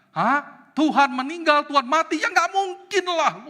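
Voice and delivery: high, loud outraged disbelief; indignant shouted protest; Martin Luther's anger. Retold or imitated. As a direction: act out an incensed husband scolding his wife. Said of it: "Martin Luther langsung marah".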